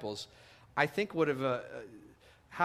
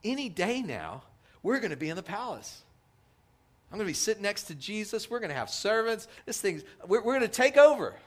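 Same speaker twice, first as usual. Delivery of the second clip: imitating Peter's excitement; 6 semitones higher, 4 dB louder than usual. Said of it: "I know what Peter's thinking".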